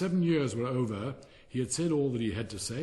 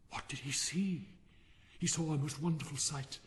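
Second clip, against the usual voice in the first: ordinary delivery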